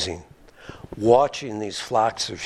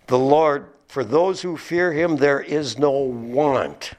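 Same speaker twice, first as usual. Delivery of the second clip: slow, raised reading voice; solemn raised Psalm reading; scripture reading; 4 semitones higher, slower than usual. Shown as a demonstration. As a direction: read the Psalm out with weight. Quoting verse again.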